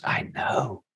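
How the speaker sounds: soft, resigned mother's reply; hushed, knowing concession; subdued admission. Retold or imitated. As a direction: admit it quietly, almost under the breath. Mother admitting trouble, subdued.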